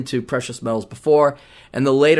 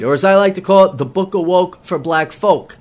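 ordinary delivery

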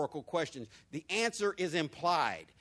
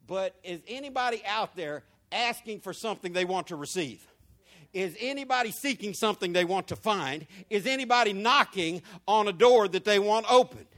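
questions growing louder to a shout; preaching, building; 6 dB louder than usual. Acted. Pastor calling to congregation, rising to loud preaching.

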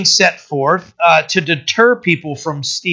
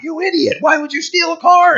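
high-pitched mock yell from an angry wife; retold wife yelling; 12 semitones higher than usual. Wife yelling at thief husband over phone, high mock yell. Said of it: "his wife's screaming at him".

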